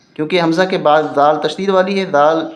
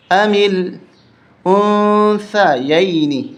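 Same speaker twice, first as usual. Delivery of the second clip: recited slowly; 3 semitones higher, much slower than usual. Shown as a demonstration. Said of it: "رواں پڑھیں گے".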